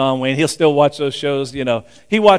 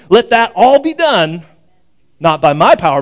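emphatic prayer declaration; 3 semitones higher, 6 dB louder than usual. Acted. Believer purposefully closing a prayer, emphatic declaration.

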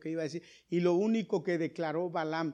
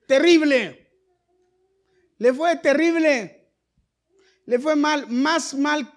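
much higher and louder, falling hard; emphatic loud; 8 semitones higher, 9 dB louder, slower than usual. Real, not acted. Preacher tells congregation how badly it went, loud emphasis. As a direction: hammer each word of misfortune. Preacher stresses sister's fate.

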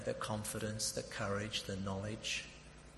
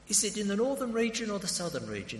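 high, quick, probing question; eager, high-pitched enquiry; closing-in question; 10 semitones higher, 3 dB louder, faster than usual. Retold or imitated. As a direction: act out narrowing down the answer. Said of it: "I get right down close".

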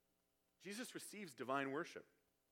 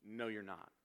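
lower, dismissive denial; retold crowd rejection; 5 semitones lower than usual. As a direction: drop the pitch and deny it. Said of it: "they say".

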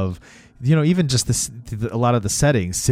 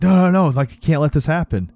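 raised, louder mock panic; retold alarmed pleading; freaking out; 6 semitones higher, 5 dB louder than usual. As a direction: act out a man frantically protesting. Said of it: "he was, like, all freaking out".

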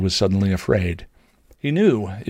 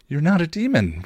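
higher, smiling reassurance; smiling affirm; 9 semitones higher than usual. A narrator's reading. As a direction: assure her gently, smiling. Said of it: "Willow affirmed, smiling".

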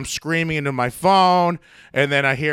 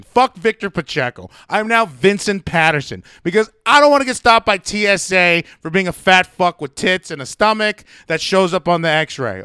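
ordinary talk, nothing marked

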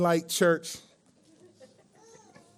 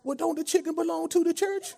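put-on old church lady voice; 14 semitones higher than usual. Prank caller asking restaurant about church, old lady voice. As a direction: ask sweetly like an elderly churchgoer.

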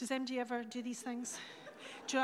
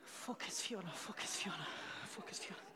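low, soft aside to oneself; hushed self-reminder; self-admonishing; 6 semitones lower, 9 dB quieter than usual. Real, not acted. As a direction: quietly pull yourself back on track. Tells herself to refocus mid-talk.

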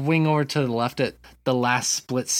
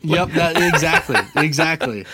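laughing; 4 semitones higher, 5 dB louder than usual. Real, not acted. Laughing agreement at friend's joke.

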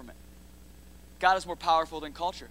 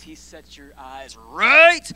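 slow punched proclamation; 2 semitones lower, 13 dB louder, much slower than usual. Real, not acted. Preacher punching a line.

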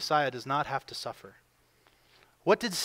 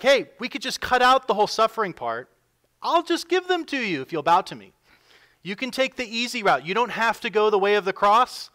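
higher, louder coaxing tempter; Satan's tempting voice; 6 semitones higher, 7 dB louder than usual. Retold or imitated. Satan tempting Jesus with the easy route, coaxing and higher. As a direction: coax him like a smooth tempter. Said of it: "And Satan is like".

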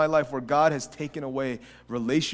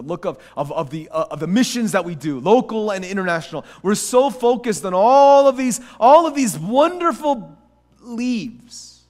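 louder, rising preacher's cry; impassioned preaching; 9 semitones higher, 14 dB louder than usual. Real, not acted. Preacher lamenting the church's wrong focus, building in force. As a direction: build to a loud, high-pitched preach.

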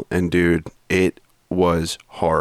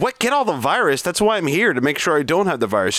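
incredulous; 8 semitones higher than usual. Retold or imitated. Patient recounting a covid test, mock incredulous. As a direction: pitch jumps up on Get all the virus. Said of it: "And I said".

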